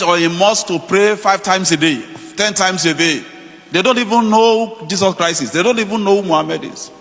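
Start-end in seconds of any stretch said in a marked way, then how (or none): none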